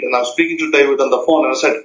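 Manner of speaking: ordinary delivery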